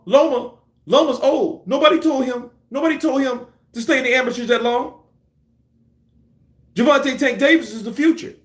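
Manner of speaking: hurried, high-pitched hype, imitating a backtracking promoter; mocking Floyd's excuses. Mimicking Floyd flip-flopping about Loma and Tank, mocking.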